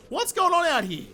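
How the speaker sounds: high-pitched disbelief; baffled outburst. Real, not acted. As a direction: sound truly baffled. Baffled by missing birds.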